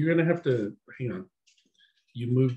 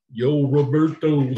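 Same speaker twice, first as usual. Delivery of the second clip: slow, loud Rocky Balboa call; Rocky impression; 3 semitones higher, 8 dB louder, slower than usual. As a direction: drawl it out like Rocky.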